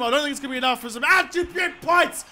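ordinary delivery

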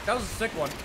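ordinary delivery

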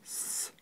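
a long voiceless whistling С on its own. Shown as a demonstration. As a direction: hiss the С sharply with no voice. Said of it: "она свистящая".

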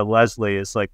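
normal talking voice

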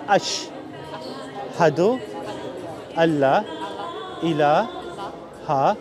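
slow Arabic, each word set apart by long pauses; very slow recitation. Shown as a demonstration. Preacher leads a new convert through the shahada, very slow. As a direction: recite word by word, very slowly, so he can follow. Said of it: "I'm gonna do it real, real slow".

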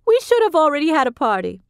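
put-on high, gushing older woman; Vivian voiced high. A narrator's reading.

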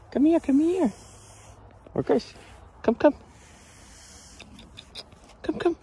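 high, loud call to a dog. Real, not acted. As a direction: call the dog over from across the yard. Calling the dog loudly.

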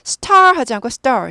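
"star" with a tense, unaspirated t like Korean ㄸ; 된소리로 star; tensed consonant. Shown as a demonstration. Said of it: "이게 뜨 발음이 되죠".

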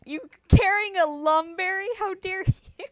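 mock outrage. Real, not acted. Mock outrage at opponent's item.

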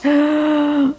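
sharp delighted gasp. Real, not acted. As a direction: gasp in amazement at the taste. Tasting the queso, amazed.

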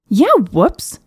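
loud mocking yell; furious shout. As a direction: throw his word back at him. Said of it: "the princess screamed".

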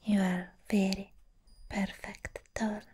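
ordinary talk

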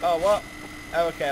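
ordinary talk, nothing marked

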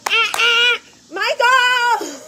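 high, loud squeal of his name; excited shouting. Retold or imitated. Passing her husband on the road, high loud excited call. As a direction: call out to him thrilled. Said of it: "and I was like".

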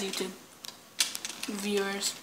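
ordinary delivery

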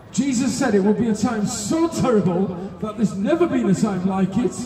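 ordinary talk, nothing marked

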